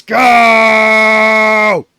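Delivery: long, loud hyped yell; hype shout. Real, not acted. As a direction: pump the crowd up.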